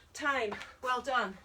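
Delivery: ordinary talk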